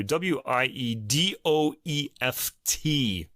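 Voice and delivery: slow letter-by-letter spelling. Real, not acted. Host spells a musician's name for listeners, slowly. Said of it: "Let me spell that name".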